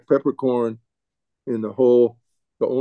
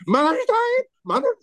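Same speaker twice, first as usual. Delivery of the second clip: put-on much higher, startled little girl; the daughter suddenly alarmed about her mother; shocked child. Daughter hears mother is dying.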